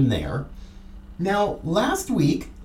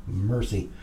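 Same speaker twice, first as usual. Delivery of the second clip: ordinary talk, nothing marked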